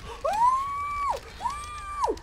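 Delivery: sudden very high, loud squeal of fright; startled high shriek. Real, not acted. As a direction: yelp as the killer appears. Startled by a chase in game.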